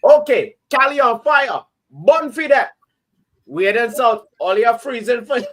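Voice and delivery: Caribbean accent. Shown as a demonstration. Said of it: "read it in your accent".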